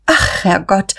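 mildly acted start of dismay; understated alarmed outburst; sudden exclamation. A narrator's reading. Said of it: "rief Guste plötzlich".

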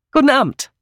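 high hearty hail; high called greeting; calling out. A narrator's reading. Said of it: "rief Miss Hinchliff".

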